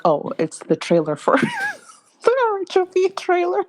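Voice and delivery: high, giggling excitement; giddy excited. Retold or imitated. Caller telling husband about the trailer, high giddy excitement. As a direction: squeal it out like you can't contain it. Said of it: "And I'm like".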